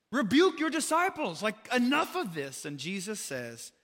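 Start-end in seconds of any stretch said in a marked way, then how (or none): 0.1-2.6 higher, louder, exasperated Pharisee complaint; indignant demand. Retold or imitated. Pharisees urging Jesus to silence his followers, indignant and louder. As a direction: demand it sharply, fed up.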